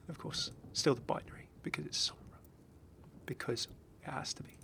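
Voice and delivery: hushed conspiratorial whisper; whispered aside. Real, not acted. Whispering an aside to viewers about Sombra, hushed. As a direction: breathe it like a little secret.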